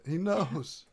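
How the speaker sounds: high, loud mock alarm; alarmed excitement. Real, not acted. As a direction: gasp it out, spooked. Reacting to character line.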